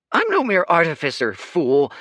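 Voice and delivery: high, loud, fast indignant yelp. A narrator's reading. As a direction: snap back shrill and fast. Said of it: "yelped Leo".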